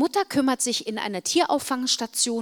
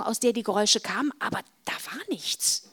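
ordinary delivery, nothing marked

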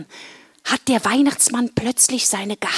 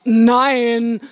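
exasperated group refusal; 5 dB louder than usual. Acted. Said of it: "sagten alle wieder gleichzeitig".

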